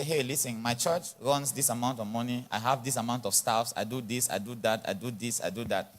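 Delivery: low, soft, self-assured pitch; quiet, confident big-shot talk. Acted. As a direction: list your assets coolly, voice dropped. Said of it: "I have big talk".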